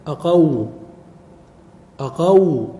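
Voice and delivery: "أقو" said alone, ending on a stressed "aw" glide; vowelless waw. Shown as a demonstration. Teacher showing the waw made vowelless, "أقو" isolated and stressed. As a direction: land firmly on the silent waw of "أقو". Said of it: "ثم الواو ساكنة".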